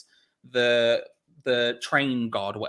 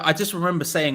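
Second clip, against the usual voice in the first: usual speaking voice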